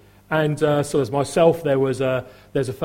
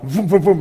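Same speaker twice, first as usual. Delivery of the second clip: engine noise; 3 semitones higher, 5 dB louder than usual. Pretend. Imitating motorbike engine.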